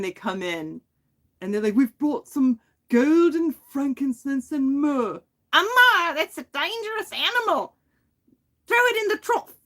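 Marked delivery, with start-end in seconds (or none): 1.8-5.2 slow, high mock-British announcement; Monty Python character. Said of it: "like, Monty Python". 5.5-9.5 very high, loud Cockney squawk; Monty Python character. Acted. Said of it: "like, Monty Python".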